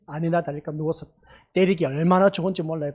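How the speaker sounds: normal talking voice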